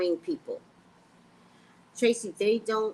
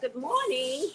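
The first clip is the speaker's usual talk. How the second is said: slow host greeting; 6 semitones higher, slower than usual. Shown as a demonstration. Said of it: "not getting the intonation".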